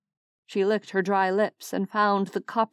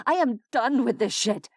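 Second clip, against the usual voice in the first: angry; 5 semitones higher than usual. Acted. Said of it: "anger coming to her defense".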